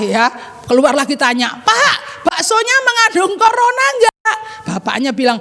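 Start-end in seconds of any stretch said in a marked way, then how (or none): 1.5-4.4 put-on high-pitched child's call to a vendor. Child asks the bakso seller about corona, calling out. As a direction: call the question over to the seller.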